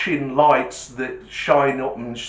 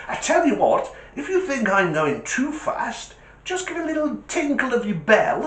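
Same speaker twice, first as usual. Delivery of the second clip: driver; 10 semitones higher than usual. Retold or imitated. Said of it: "He says".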